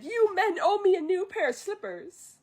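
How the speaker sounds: high, indignant complaint; a hint of an indignant Chifu; mock-angry Chifu. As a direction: play the offended counsel demanding slippers.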